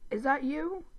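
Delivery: a tentative, lightly nervous check; nervous call. A narrator's reading. Brother checking if his sister is there, nervously. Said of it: "Dib nervously calls".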